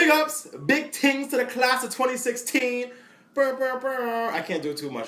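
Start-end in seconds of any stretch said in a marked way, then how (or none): 0.0-2.9 high, slow dancehall toast; big it up like a reggae MC; Jamaican dancehall shout-out. Pretend. Shout-out to graduating class. 3.3-4.3 high buzzing lip horn; air horn imitation.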